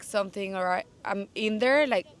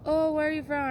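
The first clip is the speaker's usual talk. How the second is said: mock curious stranger; 8 semitones higher than usual. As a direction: play the nosy stranger, light and higher. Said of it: "And they're like".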